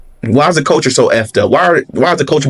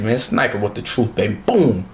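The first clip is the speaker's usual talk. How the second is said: normal talking voice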